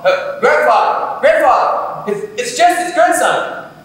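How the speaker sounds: high, loud call, slow; yelling across. Retold or imitated. Grandson calling to grandfather across the street, loud high yell. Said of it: "I yell".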